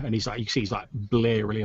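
usual speaking voice

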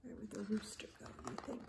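fast hushed whisper. Acted. Reader whispering a question to young listeners. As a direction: ask the kids in a secret whisper.

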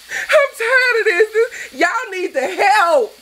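near tears, voice cracking high and slow; fighting back tears while pleading; tearful. Real, not acted. Ranting to viewers, near tears. Ranting about careless people.

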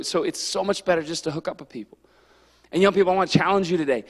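ordinary talk

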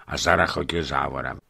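plain reading voice

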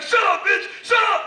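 loud, high-pitched mock bullying yell; acted angry shout. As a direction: shout her down like an abusive man. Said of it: "He's like".

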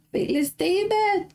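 high, delighted call of a viewer's name; light up greeting a returning friend; excited high greeting. Real, not acted. Greeting a viewer who joined.

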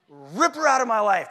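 loud, emphatic retold plea to God; 强烈恳求. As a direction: cry it out to God, stretching the words.

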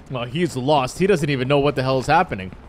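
ordinary talk, nothing marked

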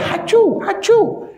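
very high, put-on "hachu"; obviously faked high sneezing noise; fake sneeze. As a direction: squeak out a pretend sneeze. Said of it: "They wasn't sneezing".

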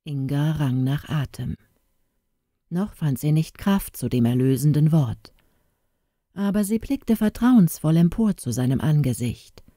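ordinary delivery

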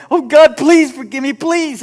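high, loud mock plea for forgiveness; imitating a panicked teenage prayer; retold begging. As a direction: beg frantically, hammed up. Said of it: "kept begging".

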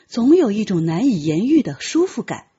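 plain reading voice

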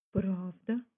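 usual speaking voice

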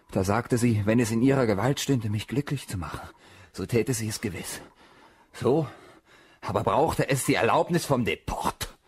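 usual speaking voice

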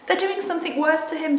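put-on high, loud anxious girl; acted alarm, pitched right up. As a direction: cry out in worry. Frightened Lucy acted in story.

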